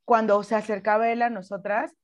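ordinary talk, nothing marked

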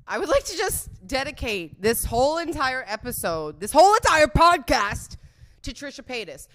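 loud, high mock announcement; grand dedication.

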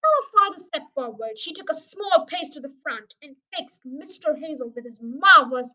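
ordinary delivery, nothing marked

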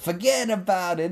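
high, hammed-up East Coast accent; exaggerated mock East Coast accent; bad East Coast accent. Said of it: "that was not my best accent".

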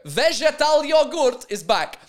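high, loud, drawn-out mock fanfare; mock triumphant announcement. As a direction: announce it like a long-awaited comeback. Gleeful return of earlier running joke.

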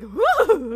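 high, loud, wavering "woo"; drawn-out ghostly whoop; imitated spooked "woo". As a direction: mimic a startled little noise, exaggerated. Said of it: "You, you made a little audible noise".

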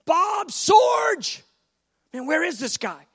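high, loud fed-up yell; imitating a fed-up clerk; exasperated shout. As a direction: shout the name, losing patience.

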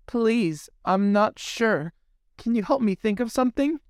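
put-on high, unsure girlish plea, rushing the end; pleading young mermaid. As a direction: ask for help, high and hesitant.

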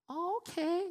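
high, sweet "oh, okay"; a light, high waitress voice. Retold or imitated. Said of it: "she'd be like".

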